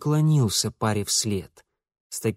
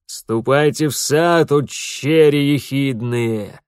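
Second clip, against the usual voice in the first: loud, slow, arms-raised proclamation; proclaiming loudly; 5 dB louder, slower than usual. A narrator's reading. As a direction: declare it grandly to the heavens. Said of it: "провозгласил Шабельский".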